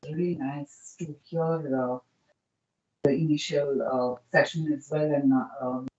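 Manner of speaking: faint and distant, far from the mic; barely audible. Real, not acted. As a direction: speak too far from the microphone. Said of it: "your voice is very low".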